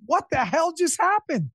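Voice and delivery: mock the wife's dumbstruck gorilla look; Magilla Gorilla face. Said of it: "like, Magilla gorilla".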